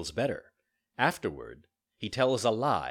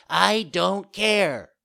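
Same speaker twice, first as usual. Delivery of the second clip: slow, loud, high bratty retort; drawn-out defiance; 10 semitones higher, 9 dB louder, much slower than usual. A narrator's reading. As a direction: stretch every word in stubborn defiance.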